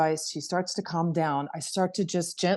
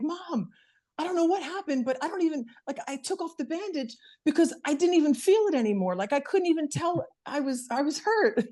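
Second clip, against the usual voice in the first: put-on higher, amazed little-girl voice; imitating her young daughter; 10 semitones higher than usual. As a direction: play the excited kid telling Mom the pain is gone. Daughter voiced in a retold story.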